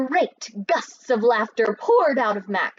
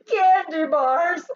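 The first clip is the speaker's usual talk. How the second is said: higher, louder, slow, through laughter; laughing shout; 8 semitones higher, 6 dB louder, much slower than usual. Acted. Mac shouts again, laughing at the thieves. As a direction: shout it while still laughing. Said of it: "the laughter beginning again".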